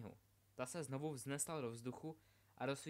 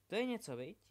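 excited; 7 semitones higher, faster than usual. Acted. Delphi marvels at a book.

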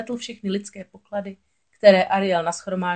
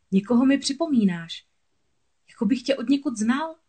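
higher, searching hopeful tone; 6 semitones higher than usual. A narrator's reading. Prince addressing the girl he found, hopeful. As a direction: lift the pitch as if nearly recognising someone. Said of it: "pronesl s nadějí v hlase".

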